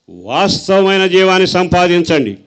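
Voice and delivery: loud high proclamation; Paul crying out. Acted. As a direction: cry it out like an apostle. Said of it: "పౌలు కేక వేసి చెబుతున్నాడు".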